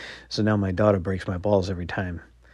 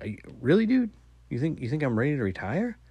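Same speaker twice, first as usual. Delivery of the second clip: incredulous; 7 semitones higher than usual. Retold or imitated. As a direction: act stung and amazed at the kid. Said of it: "lost for words".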